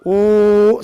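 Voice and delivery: a long rounded ऊ from lips; owl-like rounded ऊ; rounded oo sound. Shown as a demonstration. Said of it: "करिए".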